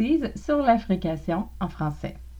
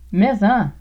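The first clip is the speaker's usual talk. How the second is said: deadpan retort; 6 dB louder than usual. Shown as a demonstration. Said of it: "vous pouvez répondre maison".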